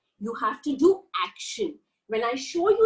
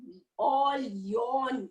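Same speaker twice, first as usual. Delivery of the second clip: normal talking voice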